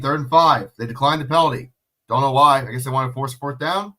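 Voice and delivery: ordinary talk, nothing marked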